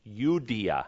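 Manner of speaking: "Iudaea" begun with a Y sound; Roman pronunciation. Shown as a demonstration. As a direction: say "Iudaea" as you-DEE-a. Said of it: "or with a Y sound".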